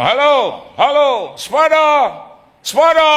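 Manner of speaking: high, loud sing-song calling at the door; hollering sepada from outside the gate; mock debt collector yelling for the owner.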